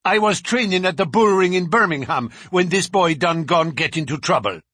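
rattled thug swearing he was elsewhere; protesting bruiser. A narrator's reading. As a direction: plead innocence loudly. Bruiser denies the killing.

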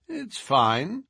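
calm amused Holmes; cool detective. A narrator's reading. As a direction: toss it off lightly. Holmes answers coolly.

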